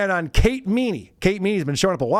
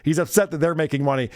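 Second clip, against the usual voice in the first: ordinary talk, nothing marked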